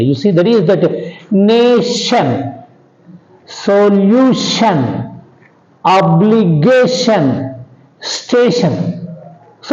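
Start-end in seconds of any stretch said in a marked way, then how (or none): 1.3-9.1 "nation, solution, obligation, station" one by one, stressing NA, LU, GA, STA; stress on second-last syllable. Shown as a demonstration. Teacher listing examples, "nation, solution, obligation, station" stressed before -tion. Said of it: "all these words also take stress on the second syllable".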